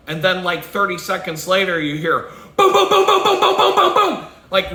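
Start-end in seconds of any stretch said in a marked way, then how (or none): 2.7-4.7 much higher and louder booming shots; loud gunshot booms. Retold or imitated. Imitating the loud shots next to the cab driver, booming. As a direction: blast out the close gunfire. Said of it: "really loud".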